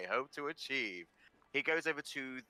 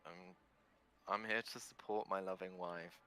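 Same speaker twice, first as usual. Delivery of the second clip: meek mumble; 5 semitones lower, 8 dB quieter than usual. Acted. Meek husband Henry.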